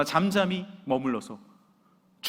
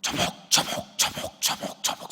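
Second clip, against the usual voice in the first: heavy boot steps in voiceless rhythm; rhythmic, breath-driven stomping noise; imitated marching footsteps; slower than usual. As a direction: chop out the tramp of marching troops. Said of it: "군대가 진격하는 소리와 같다".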